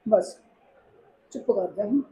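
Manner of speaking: soft, motherly comforting; quiet, tender coaxing; soothing hush. Acted. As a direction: gently hush someone who is crying. Older woman hushes and feeds crying girl.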